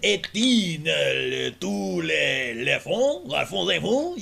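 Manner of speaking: slow, mock French accent; mock French gibberish. Imagining Canadians in the car, slow mock French accent. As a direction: babble fake French slowly. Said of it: "they go".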